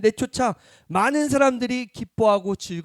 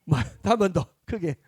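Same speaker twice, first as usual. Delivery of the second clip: ordinary delivery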